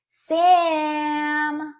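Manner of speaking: high, loud call of a name. Acted. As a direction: call Sam eagerly from afar. Girl calls out to Sam.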